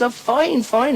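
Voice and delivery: high falsetto wife on the phone; put-on high female voice; Murphy's wife. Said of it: "She says".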